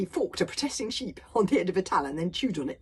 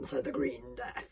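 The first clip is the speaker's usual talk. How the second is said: plain reading voice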